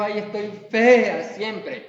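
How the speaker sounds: hammed-up complaint; mock moaning. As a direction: wail it half-jokingly. Woman moaning at the mirror.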